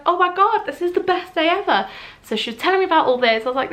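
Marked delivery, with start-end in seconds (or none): 0.1-1.9 high-pitched, gushing retold excitement; delighted reaction. Retelling her reaction to a friend's pregnancy news, delighted. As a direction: re-enact being thrilled, pitched well up.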